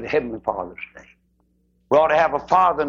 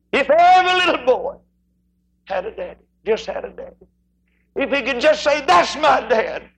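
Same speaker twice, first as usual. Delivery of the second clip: impassioned preaching; 10 semitones higher, 3 dB louder than usual. Acted. Preacher pleading for fathers, impassioned. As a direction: plead with the whole church.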